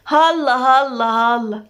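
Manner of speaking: slow, drawn-out mock amazement; teasing. Nada hears her friend's secret, teasing. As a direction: stretch each word to tease her. Friend teases at juicy revelation.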